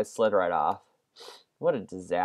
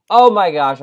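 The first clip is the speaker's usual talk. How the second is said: exclaiming; 7 semitones higher, 13 dB louder than usual. Real, not acted. Fails jump.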